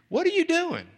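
curious question. Retold or imitated. Curious stranger asks churchgoer.

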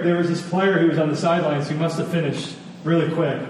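ordinary talk, nothing marked

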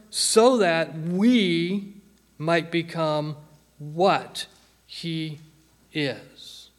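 very slow, word by word; drawn-out key phrase, falling away at the end; slow, deliberate. Real, not acted. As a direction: spell it out with weighty pauses. Spelling out the key second part.

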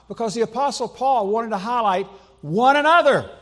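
loud, high push on one another; emphatic preaching. Real, not acted. Preacher stressing Paul's point to the church, loud and high. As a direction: drive home the words one another.

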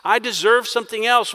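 selfish whine. Acted. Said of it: "It's almost as if he's saying".

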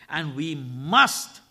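"must" slow and loud; stress on must. Shown as a demonstration. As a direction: drag out "must" and hit it. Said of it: "underline the word must".

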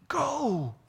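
high, drawn-out mock-solemn GO; dramatic scripture cry. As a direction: imitate readers stretching out GO. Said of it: "they say it like that".

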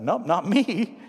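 ordinary delivery

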